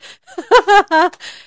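loud high laugh; real laugh bursting out; burst of laughter. Real, not acted. Big laugh at story.

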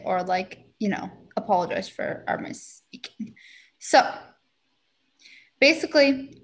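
normal talking voice

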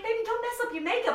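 high, fast, fussing mom voice; doting mom. Retold or imitated. Mom on the call fussing over her makeup, high and quick. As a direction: coo at her like a fond mother. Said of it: "My mom's like".

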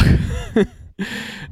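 short unvoiced laugh; amused breathy snicker; breathy chuckle. Real, not acted. Laughs at coincidence with birthday.

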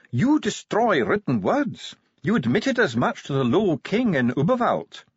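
high-pitched indignant dwarf; Ardent, accusing. A narrator's reading. Dwarf accuses the commander of destroying words, indignantly.

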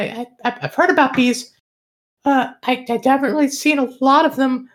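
high, fast little bat voice. Acted. GM voicing the bat.

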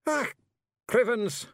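high, loud Scottish oath; exasperated Scots warrior. A narrator's reading. Tiny warrior flings down his sword, exasperated cry. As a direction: burst out in frustrated Scots. Rob exclaims in frustration.

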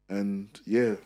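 ordinary talk, nothing marked

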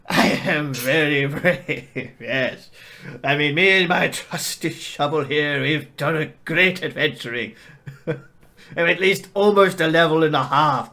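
loud, slow boastful brag. Acted. Farmer bragging about his trusty shovel, loud and proud. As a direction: puff up and boast.

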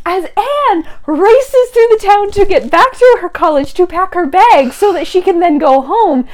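loud, high, breathless build to a shout; excited climax. Real, not acted. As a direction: tell it with mounting thrill, shouting the ending.

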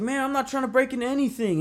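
high, fast protest; acted-out indignant denial; yelled denial. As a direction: play the cornered intruder loudly denying it. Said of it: "this yelling match".

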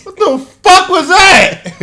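loud, high, slowed yell cracking with laughter; mock shocked laugh. Imagining the co-host's reaction, shouted laughing disbelief. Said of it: "probably looked at him like".

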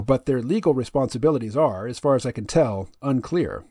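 ordinary talk

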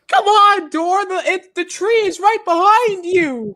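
very high, loud exasperated yell; Crazy Steve. Retold or imitated. Watching Dora and yelling at her, frantic shouted impression. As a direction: scream at the cartoon as if she can hear. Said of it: "and he's just like".

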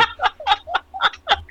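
very high laughter; real burst of high cackling; laughing hard. Real, not acted. Comic cracking up at his own line, high laughter.

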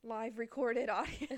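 slow loud mock announcement; announcer. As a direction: announce it grandly, slowly.

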